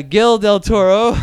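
Gil Del Toro said slow and loud with a hard G; drawn out Gil Del Toro, hamming the wrong name; mispronounced name. Real, not acted. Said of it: "his first name I can never say".

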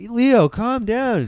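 put-on high, drawn-out plea to a kid; retold uncle reining in a child, stretched out; mock exasperated scolding. Uncle retells scolding his nephew.

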